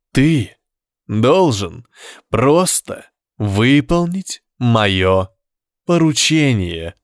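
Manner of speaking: drawn-out, deliberate command; slow hypnotic voice. Acted. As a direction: spell it out slowly, hypnotizing. Devil hypnotizes the painter. (медленный гипнотический голос)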